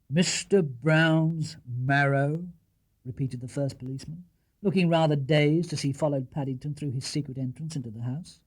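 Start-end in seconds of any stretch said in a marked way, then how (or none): 0.1-2.5 very slow, baffled repeat; can't believe his ears; puzzled. Acted. Policeman repeating the bear's words in disbelief.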